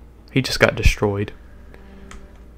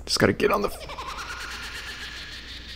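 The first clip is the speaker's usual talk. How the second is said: panicked laugh; 9 dB quieter, much slower than usual. Real, not acted. Chased, laughing scream.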